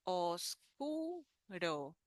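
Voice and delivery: "Oscuro" very slow, broken into os, cu, ro; syllable by syllable. Shown as a demonstration. Teacher showing the O sounds in oscuro, slowly syllable by syllable. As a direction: softly separate each syllable of "Oscuro". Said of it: "We have the O sound in the beginning".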